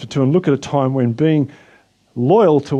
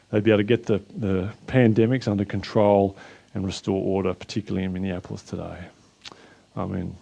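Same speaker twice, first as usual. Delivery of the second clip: soft, lowered prayer voice; praying earnestly over the nations; 3 semitones lower, 4 dB quieter than usual. Real, not acted. Pastor prays for America in a soft, lowered voice.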